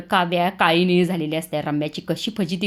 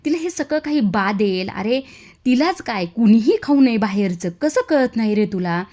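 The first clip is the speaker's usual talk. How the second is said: louder and louder, building to a yell; mother yelling at her son about outside food; yelling scold; 4 semitones higher, 6 dB louder than usual. Acted. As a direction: give him a real earful. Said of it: "जीवावर जास्तच ओरडते".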